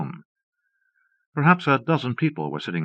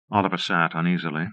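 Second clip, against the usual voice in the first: ordinary delivery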